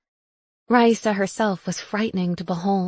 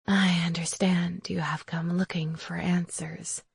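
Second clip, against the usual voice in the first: put-on softer, whispered old crone; whispering witch; 2 semitones lower, 6 dB quieter than usual. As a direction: whisper it like a sinister hag. Witch Raisa speaks.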